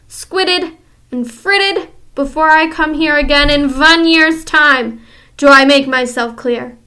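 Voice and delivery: loud, high, imperious orders; commanding witch. Acted. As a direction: bark the orders at them.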